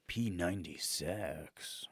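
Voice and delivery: low, slow and soft sultry purr; sultry joke. Pretend. Joking about a workout brand, slow seductive purr. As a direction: purr it like a naughty ad. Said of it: "We're like".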